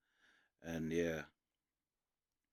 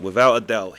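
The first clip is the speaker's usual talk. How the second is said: loud, emphatic opening of the quote; 3 semitones higher, 15 dB louder than usual. Retold or imitated. Podcaster quoting [name] on [name], loud emphatic boast. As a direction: belt it out like a confident rapper.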